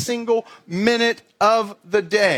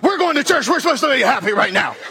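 high, loud, fast mock yell in the car; mock angry yell; 5 semitones higher, 3 dB louder, faster than usual. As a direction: snap at the kids with a laugh. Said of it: "you're like".

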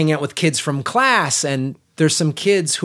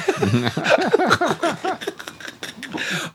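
long laugh; 9 semitones higher, 3 dB quieter than usual. Real, not acted. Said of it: "I'm really into this".

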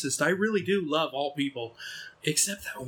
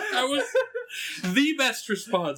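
higher, laughing through the words; 6 semitones higher, slower than usual. Real, not acted. Laughing at friend's reaction.

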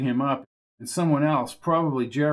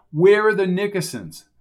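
angry demand; 5 semitones higher, 7 dB louder than usual. Acted. Jones demands the location.

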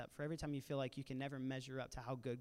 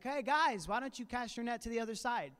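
calling out; 7 semitones higher, 5 dB louder than usual. Retold or imitated. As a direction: call across the water, raised and high. Stranger calling from the shore.

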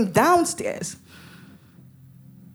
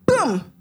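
sudden, higher "boom" for a shot; imitated gunshot; 4 semitones higher, 3 dB louder than usual. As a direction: voice the gun going off. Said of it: "they shot directly at him".

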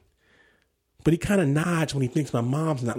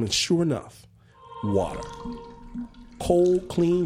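usual speaking voice

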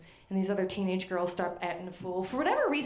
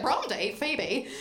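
bad British accent; 4 semitones higher, slower than usual. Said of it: "I can't do a British accent".

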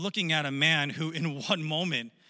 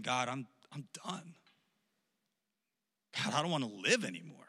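soft, low, defeated plea; hushed admission of being done; despairing prayer; 3 semitones lower, 5 dB quieter than usual. Acted. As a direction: act out giving up, quietly and drained. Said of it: "moments later, he's saying".

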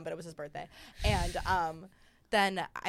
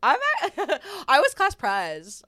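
laughing; 10 semitones higher, 14 dB louder, slower than usual. Real, not acted. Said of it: "I can't tell if you're kidding".

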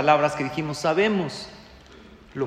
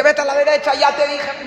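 high, loud impatient snap; loud exasperated nagging; irritated nag; 10 semitones higher, 7 dB louder than usual. Pretend. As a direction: scold like an exasperated wife. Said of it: "No te dice".

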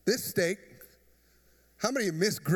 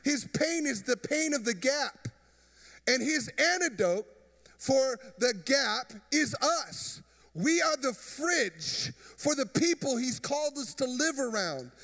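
full force preaching; 5 semitones higher than usual. Acted. Pastor urges the congregation to be the fridge, preaching full force. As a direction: preach it out to the back pew.

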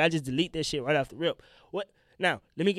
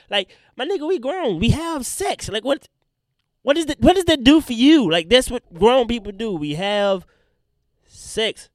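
high, loud indignant rant; going off on them in disbelief; real rising indignation; heated rant; 7 semitones higher, 8 dB louder than usual. Real, not acted.

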